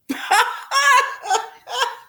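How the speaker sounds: very high mocking cackle; evil cackle. A narrator's reading. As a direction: cackle like she knows his doom. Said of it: "Melinda laughed".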